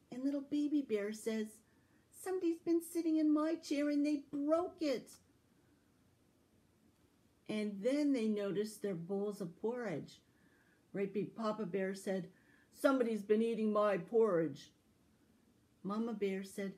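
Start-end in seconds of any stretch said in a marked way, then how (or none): 2.1-5.2 higher, louder little bear voice; Baby Bear wailing that his chair broke, high little voice. Acted. Baby Bear's line. 12.7-14.7 papa bear. Acted. Papa Bear's line.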